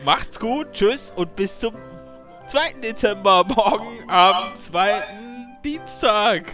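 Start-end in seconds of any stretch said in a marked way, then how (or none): none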